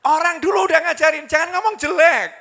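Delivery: high, loud admonishment; emphatic exclamation. Real, not acted. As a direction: drive the point home at full voice. Preacher exclaims main point forcefully.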